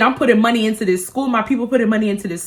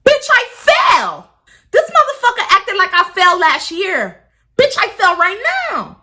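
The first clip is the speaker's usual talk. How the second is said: shrieking indignation at the boss; outraged yell; 10 semitones higher than usual. Real, not acted. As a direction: blow up in disbelief. Outraged at boss's response.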